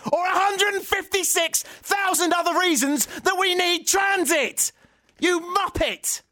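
high, drawn-out yell of disbelief; exasperated shout. Real, not acted. Radio host losing it over a mayor's quote, high drawn-out shout. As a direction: scream it out in full frustration. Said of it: "Sometimes you gotta shout".

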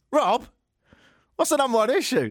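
high, eager mock question; mock fan voice. Radio host mocking a fan asking Rob, high and eager.

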